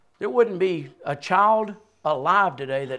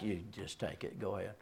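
much softer, resigned handing it over; muttered giving up; 9 semitones lower, 18 dB quieter than usual. Retold or imitated. Preacher quoting himself giving up the cube, softly. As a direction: hand the cube off quietly. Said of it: "and I'm like".

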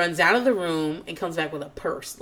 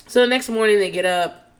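ordinary talk

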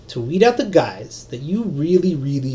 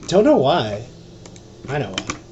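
ordinary delivery

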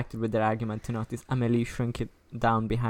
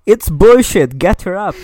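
scoffing order; 7 semitones higher, 16 dB louder than usual. Acted. Priest scoffing at faked illness.

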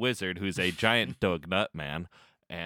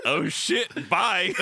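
startled exit; 8 semitones higher, 4 dB louder, much slower than usual. Retold or imitated. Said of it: "I was like".

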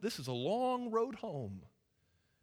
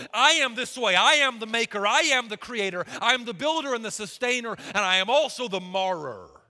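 louder, declaring each I am; proclaiming as God; 7 dB louder than usual. Acted. Preacher voicing the Lord to Jeremiah, loud and declaring. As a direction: ring out every line as a decree.

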